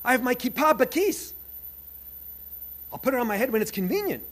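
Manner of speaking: gleeful, proud to belong; elated inner voice. Retold or imitated. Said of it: "I went".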